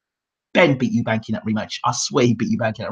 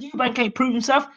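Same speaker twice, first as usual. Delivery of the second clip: defiant boast; 10 semitones higher than usual. Retold or imitated. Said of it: "I said".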